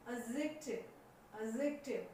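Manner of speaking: "एडजेक्टिव" revised as a-zek-tiv; silent D. Shown as a demonstration. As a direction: recite "एडजेक्टिव" without its D. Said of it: "silent रहेगा". ("एडजेक्टिव" में डी silent)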